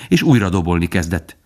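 ordinary delivery, nothing marked

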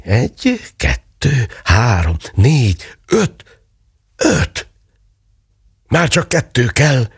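rising thrill with each coin; a mother counting found coins, giddy with joy; excited counting. A narrator's reading.

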